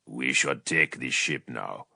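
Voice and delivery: low, heavy and solemn; mournful rich voice. Acted. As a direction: state it with grave sorrow. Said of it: "mournful timbre of Vulcan's sons".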